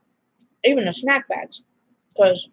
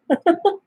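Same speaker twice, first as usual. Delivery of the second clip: giggle; 9 semitones higher than usual. Real, not acted. Laughs about heartburn.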